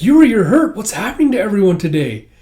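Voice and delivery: high, loud, acted dismay; alarmed concern. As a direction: cry out in worry for your brother. Yor alarmed at brother's injury.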